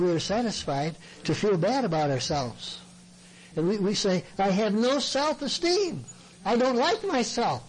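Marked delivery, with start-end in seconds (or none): 4.4-7.6 mock self-pity. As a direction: imitate someone wallowing, pitched well up. Mimics self-pitying counselee.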